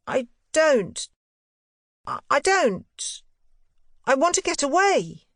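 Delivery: put-on high, halting boyish voice, strained; a sick boy struggling to say it; boy Miles. A narrator's reading. Boy telling his governess he wants away, high and halting.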